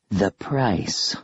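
lightly voiced hissing undead; a hint of a hissing ghoul; hissing creature. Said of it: "the creature said".

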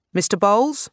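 loud high call, different timbre; a voice calling through the door; called from outside. A narrator's reading. The officer calls to Bowles from outside. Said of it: "The officer called again".

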